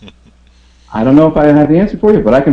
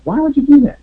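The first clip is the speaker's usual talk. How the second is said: incredulous; 4 dB louder than usual. Real, not acted. Rhetorical disbelief at lone effort.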